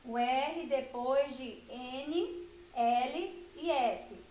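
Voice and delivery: o R depois de N, L e S said very slowly, each letter spaced out; slow emphasis. Shown as a demonstration. Teacher to children stressing the rule slowly. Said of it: "Olha só".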